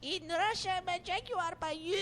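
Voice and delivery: put-on very high, soft Russian kid voice; high, soft mock Russian child accent. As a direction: squeak it out like a little Russian boy. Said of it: "You have to do it in a Russian child accent".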